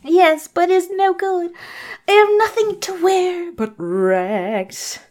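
high, loud plaintive put-on voice; hammed-up high lament, dragging at the end; Cinderella. Cinderella answering the old lady's offer, high plaintive voice. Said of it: "why I'm using that voice".

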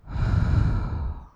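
long breathy whispered sigh; soothing sigh. Acted. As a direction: let out a slow calming sigh. Comforting, exhaling together.

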